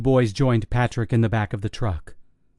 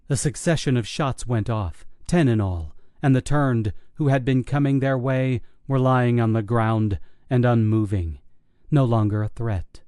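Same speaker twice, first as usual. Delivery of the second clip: ordinary delivery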